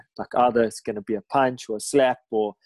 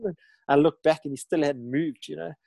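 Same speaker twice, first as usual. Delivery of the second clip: ordinary talk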